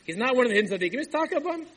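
loud exclamation, rising high; emphatic outburst. Acted. Visitor's sender tells him about the drunkard, emphatic outburst. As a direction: burst out with it, pitch climbing sharply.

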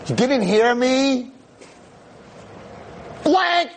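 high, slow furious yell; angry yell. Retold or imitated. Frustrated teen girl yells at the silent rabbi, high and furious. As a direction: bark it at him. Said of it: "she's like".

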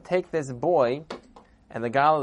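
ordinary delivery, nothing marked

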